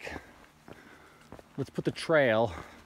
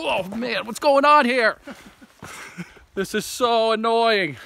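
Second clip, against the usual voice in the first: much higher, louder, slowed complaint; frustrated; 7 semitones higher, 4 dB louder, slower than usual. Real, not acted. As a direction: vent it, exasperated. Frustrated in swamp.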